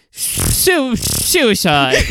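"suicide" begun twice with a long hissed "shoo"; lispy suicide. Retold or imitated. Demonstrating the actor's odd delivery, lispy suicide. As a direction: imitate a lisp, shushing the S in "suicide". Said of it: "kind of like a lispy".

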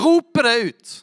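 loud, high, stretched shout. Shown as a demonstration. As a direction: call it out at full volume. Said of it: "jeg gjør et poeng ut av".

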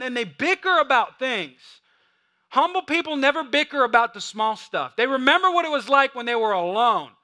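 high, loud preacher's cry; full-force preaching. Acted. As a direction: drive it home to the congregation.

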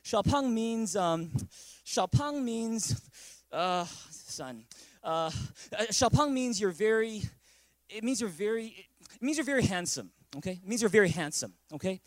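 imitating a stalling, hesitant dad; struggling for an answer. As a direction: stall and grope for a kind fib, drawing out words. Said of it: "he struggled with giving me the".

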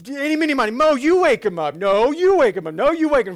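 high, loud frantic squabble; mock-terrified disciples arguing; panicked bickering. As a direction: push the job off on each other in a panic. Scared disciples arguing in boat.

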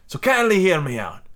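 put-on lilting, hammy foreign accent for a sea captain; theatrical captain with an unplaceable European accent; mystery foreign accent. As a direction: explain it in a wandering, vaguely French accent. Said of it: "I don't know what accent this is".

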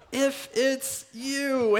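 "If" stretched very slowly and leaned on; stress on IF. Shown as a demonstration. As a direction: draw out "If it's you", weighing the word If. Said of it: "And this "if" really is".

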